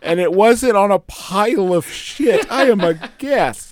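high, loud hammed-up indignation; mock outrage. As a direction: play the offended guest, over the top. Mock indignant guest rant.

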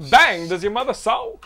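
mock Christopher Walken, hammed-up; Christopher Walken impression. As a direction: lean into a loud, odd Christopher Walken delivery. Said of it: "that's Christopher Walken from, uh, Joe Dirt".

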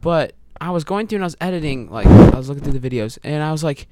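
normal talking voice

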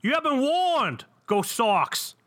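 slow, high, hammed-up Boston accent; bad Boston accent. Cohost opens the podcast with a bad Boston accent. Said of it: "I suck at accents".

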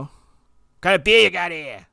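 slow Boston ordering, no R in beer; slow mock Boston order at the bar; imitated Boston accent. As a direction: drop the R in beer like a local. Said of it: "I didn't ask for beer".

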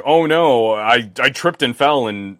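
loud, high fake "oops"; mock dismay. Joking that nobody buys an expensive phone by accident, mock dismay. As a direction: play up the innocent accident, starting loud and high.

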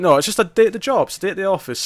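easygoing pregnant detective shrugging off a murder case; casual Minnesota cop. Retold or imitated. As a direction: toss it off like it's nothing. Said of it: "She's like".

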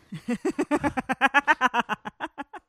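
high burst of laughter; cackling laugh. Real, not acted. Cracking up at being hunted down for sport, cackling laugh.

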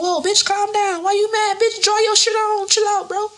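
high-pitched incredulous comeback; mock exasperation. Retelling her comeback to a mad hairdresser, mock exasperated. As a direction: act out a squeaky-high "calm down" retort.

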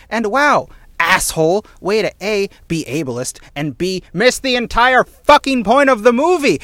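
high, loud indignant rant; exasperated rant. Real, not acted. Podcaster ranting back at a critic's review, exasperated and loud.

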